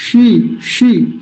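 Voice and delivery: शी with the tongue curled back, long ee; slow, isolated retroflex शी. Shown as a demonstration. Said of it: "मूर्धन्य शकार का अच्छे से उच्चारण". (मूर्धन्य शी का उच्चारण)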